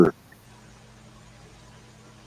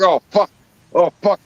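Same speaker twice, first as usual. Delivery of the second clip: high-pitched mock panic; imitating someone caught off guard by a big bite; retold alarm; 10 semitones higher than usual. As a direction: mimic the uncle's repeated startled curse, pitched well up. Uncle hooks fish in retold story.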